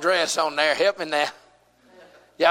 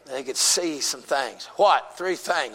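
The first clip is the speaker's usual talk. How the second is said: usual speaking voice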